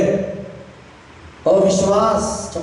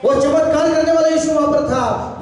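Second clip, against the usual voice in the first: high shouted proclamation; impassioned sermon cry on a sustained high pitch; full-force preaching; 8 semitones higher than usual. Real, not acted. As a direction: declare it to the whole congregation.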